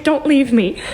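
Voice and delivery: voicing a clingy plea; pleading being. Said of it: "type feeling".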